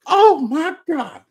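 high, slow delighted "oh my God"; surprised gasp. Retold or imitated. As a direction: act thrilled by the surprise. Wife surprised by gift.

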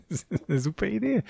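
high, laughing through the words. Real, not acted. Player laughing at his risky diamond plan. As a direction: laugh it out, amused at himself.

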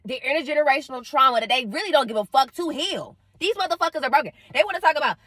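usual speaking voice

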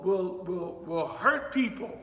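ordinary talk

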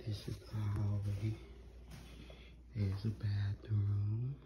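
soft, half-whispered, voice nearly gone; quiet excited whisper about the big day; hushed lost voice. Real, not acted. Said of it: "I'm talking so calm because I believe that I lost my voice".

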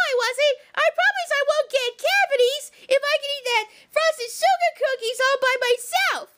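ordinary talk, nothing marked

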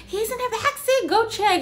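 high, pushy wife voice ordering him to check; mock wife shoving her husband toward danger; imitating the wife. Imitating wife telling husband to go check, high and pushy.

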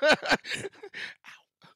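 real laugh breaking into an ow; laughing through a sudden hurt; laughing in pain. Real, not acted. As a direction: burst out laughing, then yelp. Hurts ankle while laughing.

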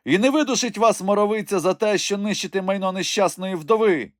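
high crone voice, cursing; angry old widow. Acted. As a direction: lament the broken door in fury. Widow curses soldiers. (гнівна висока стара вдова)

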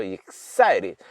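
EXCITED stressed on the middle "SAI". Shown as a demonstration. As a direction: punch "SAI" in EXCITED, light "rid" after. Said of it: "[name]의 악센트가 있죠".

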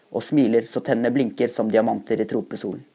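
plain reading voice

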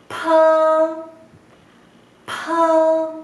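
pa with a strong breath burst; aspirated pa. Shown as a demonstration. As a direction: blow the paper with the air on pa. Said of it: "hay aire sale desde tu boca".

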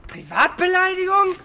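high, incredulous echo; mocking disbelief. Real, not acted. Scoffing at insult claim.

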